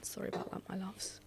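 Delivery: soft whispered apology; whispering. Real, not acted. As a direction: breathe it out, no voice. Aside after coughing.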